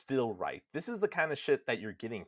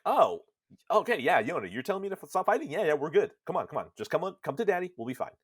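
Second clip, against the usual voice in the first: put-on higher-pitched, eager dad coaxing his child; mock eager welcome, pitched up, tumbling out; eager relief; 6 semitones higher, 4 dB louder, much faster than usual. Nier coaxing his daughter Yona to come to him, eager and relieved. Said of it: "our Nier's like".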